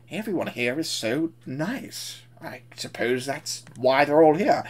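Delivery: slow, thoughtful put-on voice; musing. Bowler thinking to himself in the works, musing. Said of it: "he's having that thought of".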